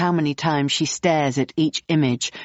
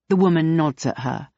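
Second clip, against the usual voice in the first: ordinary delivery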